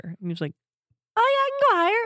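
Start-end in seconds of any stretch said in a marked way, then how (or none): 1.2-2.0 much higher, squeaky voice; imitating a high voice. Voice actor showing how high he can go, squeaky. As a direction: push the pitch way up. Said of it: "Can you go higher?".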